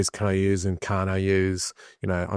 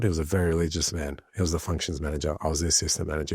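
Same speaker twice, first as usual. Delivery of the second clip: ordinary talk, nothing marked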